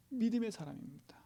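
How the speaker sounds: 믿음의 사람입니다 softly whispered to the congregation. Shown as a demonstration. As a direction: repeat it as a hushed secret. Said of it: "repeated in a whisper".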